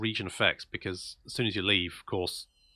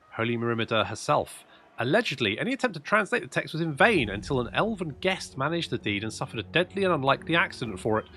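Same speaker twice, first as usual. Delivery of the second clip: higher, animated narration voice; dramatic event reading; 5 semitones higher than usual. Acted. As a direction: read the lore like a storyteller. Reads event text aloud.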